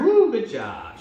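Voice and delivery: a bright cheer for the dog. Shown as a demonstration. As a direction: whoop it up for her. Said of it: "you let go and you cheer".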